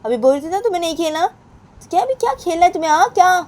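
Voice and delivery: high, loud scolding mother; angry mother. Acted. Mother scolding Sonam for skipping study, high and loud. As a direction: snap at the child who won't study.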